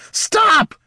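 sudden high, loud shriek. A narrator's reading. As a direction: scream it in panic. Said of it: "shrieked Barty".

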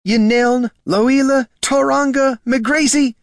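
very high, loud, slow fussy elf; drawn-out scolding of the full name; excitable elf agent. Acted. Agent Leary dragging out Catha's full name, high and slow.

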